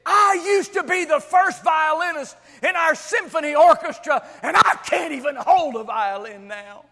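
loud anguished shout, starting high; angry, bitter outburst. Retold or imitated. Injured violinist in the camp shows her mangled hands, angry outburst. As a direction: shout the loss out angrily. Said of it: "and sarcastically and angrily".

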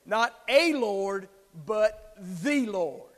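slow, stress on "the" Lord; slow with "the" punched. Real, not acted. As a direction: lean hard on "the". Contrast of a and the.

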